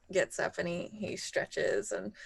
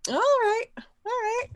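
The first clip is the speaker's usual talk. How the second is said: squeaky, drawn-up voice before a roll; high-pitched readiness; 4 dB louder than usual. Real, not acted. Player told to make a check, high playful agreement. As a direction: pitch it right up, playfully game.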